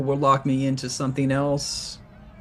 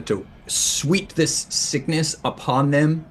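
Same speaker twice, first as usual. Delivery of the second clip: ordinary delivery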